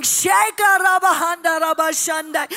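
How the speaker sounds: loud desperate praying; fast pleading tongues prayer; frantic prayer in tongues. Retold or imitated. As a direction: pray out loud in a panic. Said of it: "I'm there on my knees going".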